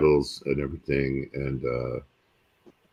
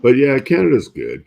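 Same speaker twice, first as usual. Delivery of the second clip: emphatic; 9 semitones higher, 11 dB louder than usual. Real, not acted. Enthusiastic praise of Canada.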